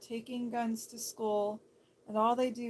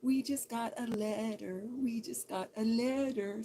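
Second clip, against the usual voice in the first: Blue's Clues quote. Retold or imitated. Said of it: "they go".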